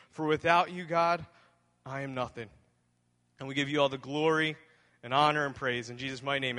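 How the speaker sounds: earnest, reverent pastor's prayer. Acted. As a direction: pray sincerely for the congregation. Leads a prayer.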